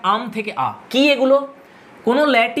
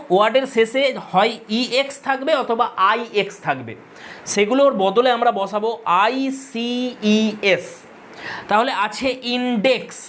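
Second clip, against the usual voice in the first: ordinary talk, nothing marked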